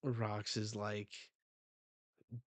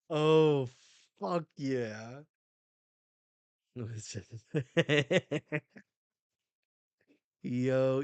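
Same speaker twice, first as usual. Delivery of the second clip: normal talking voice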